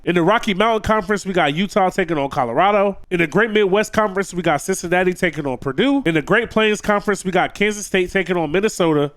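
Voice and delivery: normal talking voice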